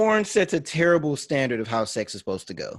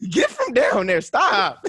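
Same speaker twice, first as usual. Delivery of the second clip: imitating a woman; 7 semitones higher, 3 dB louder, slower than usual. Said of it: "she's like".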